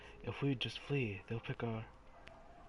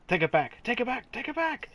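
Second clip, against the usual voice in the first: shouted, each repeat climbing much higher; mock ghostly shout; 13 semitones higher, 6 dB louder than usual. Gamer reading a cursed note, shrieking higher each time. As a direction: build from a loud cry to a high shriek.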